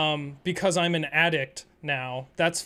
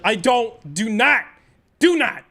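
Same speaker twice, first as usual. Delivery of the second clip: louder and higher each time, insisting; retold insistence building to a loud no; firm refusal; 4 semitones higher, 6 dB louder than usual. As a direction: shut him down, rising. Refusing insurance, repeated.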